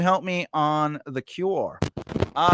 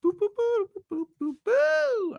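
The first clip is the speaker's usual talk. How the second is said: high, soft falsetto "boo boo boo"; playful booing noises; 3 dB quieter than usual. Pretend. Host filling dead air, high playful noises. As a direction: toot a string of high little boos.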